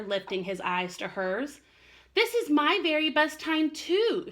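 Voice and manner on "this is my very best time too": high, sweet motherly voice; sweetly. Acted. Mother raccoon answering Chester, sweet and loving. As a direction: coo it lovingly to your child. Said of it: "she told him sweetly".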